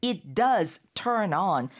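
"does" hit firmly in a slow, spaced-out phrase; stress on DOES. Shown as a demonstration. Teacher repeating the emphatic form, stress on DOES. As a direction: punch DOES, then let it fall. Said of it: "강조하는 말이 되는데요".

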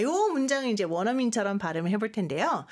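ordinary talk

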